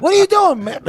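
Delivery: high, loud startled yell; acted outraged challenge. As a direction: shout it in high-pitched outrage. Acting out being caught grinding.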